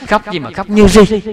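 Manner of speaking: laughing. Real, not acted.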